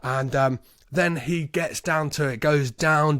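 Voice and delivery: ordinary delivery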